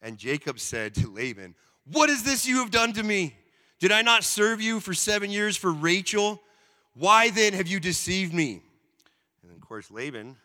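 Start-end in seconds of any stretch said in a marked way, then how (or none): 1.8-8.6 loud, higher, indignant accusation; first choppy take, outraged and loud; choppy angry read. Acted. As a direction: confront the deceiver, pitched up and loud. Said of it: "That got a little choppy".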